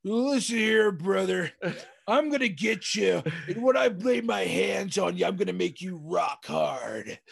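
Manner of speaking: hammed-up wrestling promo, shouted; heroic rallying shout. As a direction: growl it like a bad-guy wrestler. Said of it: "wrestling promo ... you're the bad guy".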